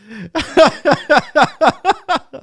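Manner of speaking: high-pitched chuckling; laughter. Real, not acted. Amused by people upset over politics, laughing. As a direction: laugh it out freely.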